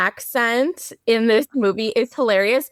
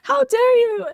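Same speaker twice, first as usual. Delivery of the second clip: mock outrage; 14 semitones higher than usual. Retelling a character's reaction to spilled wine, in mock outrage. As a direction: pitch it way up, theatrically appalled. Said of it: "she's just like".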